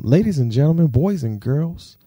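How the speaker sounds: ringmaster announcing the star; grand entrance announcement. Acted. Announcing the guest onto stage.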